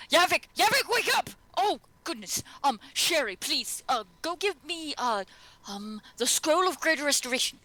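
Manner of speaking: high, urgent cry to wake a fallen friend; panicked Gilmore. Acted. Gilmore shouting at unconscious Yavik, high and frantic. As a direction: call out in alarm, then hurried orders.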